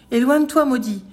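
high, loud cry of dismissal; shouted rebuff. A narrator's reading. Old man cries out at the returning ogre, shouted. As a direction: cry out to drive the intruder off. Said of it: "lui cria-t-il".

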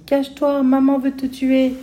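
put-on high, urgent child's shout; child's cry. A narrator's reading. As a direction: cry out a warning to hide. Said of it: "en criant à Rosie". (enfant qui crie, affolée)

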